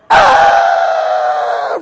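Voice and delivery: long, loud comic scream; hammed-up falling scream. Comic falling down the stairs, long scream. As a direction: scream as if tumbling over a railing.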